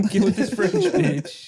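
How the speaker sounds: mock French flirt.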